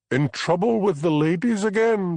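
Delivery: high, loud boom; the minister's wife booming a teasing jab. Acted. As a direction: boom it at him like a formidable matron. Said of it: "she boomed".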